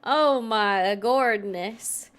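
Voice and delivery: usual speaking voice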